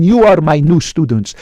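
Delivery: ordinary talk, nothing marked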